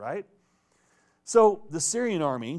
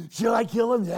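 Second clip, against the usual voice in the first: "Should I kill him?" repeated much higher and louder, eager to strike; eager shout; 7 semitones higher, 7 dB louder than usual. Retold or imitated. King eagerly pressing Elisha for the kill, shouting.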